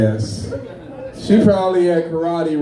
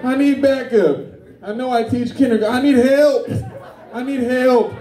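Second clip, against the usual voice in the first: high-pitched emergency radio call; hammed-up plea for backup over a walkie-talkie; mock panic; 8 semitones higher than usual. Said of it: "I went on the walkie-talkie".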